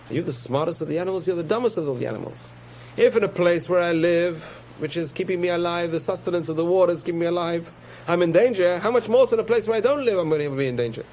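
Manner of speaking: high, indignant fish voice; a high fish scoffing at the fox; scornful fish. Acted.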